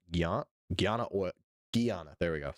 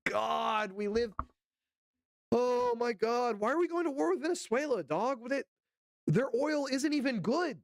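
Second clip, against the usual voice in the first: much higher, incredulous outburst; can't believe it, voice climbing; high-pitched disbelief, partly slowed; exasperated rant; 8 semitones higher than usual. Real, not acted. Ranting about war with Venezuela.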